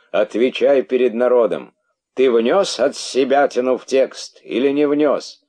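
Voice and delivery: loud, high interrogation; angry demand. A narrator's reading. King making the herald answer before everyone, angry.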